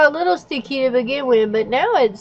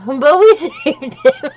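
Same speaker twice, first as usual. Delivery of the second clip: laughing; 6 semitones higher, 3 dB louder, slower than usual. Real, not acted. Laughing while talking.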